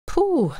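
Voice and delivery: high exhale after holding breath; relieved puff. A narrator's reading.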